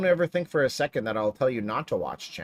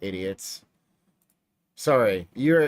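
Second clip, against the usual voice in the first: ordinary talk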